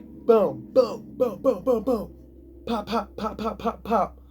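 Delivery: lightly voiced punching noises; punch sound effects. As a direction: rattle off the jabs like a flurry. Imitating rapid punches.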